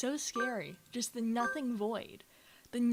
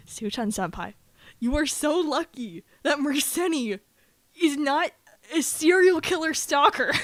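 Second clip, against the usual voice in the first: loud, raised scolding of a friend; exasperated yelling; 3 semitones higher, 8 dB louder than usual. Retold or imitated. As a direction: retell it as an emphatic telling-off. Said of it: "I had to yell at her".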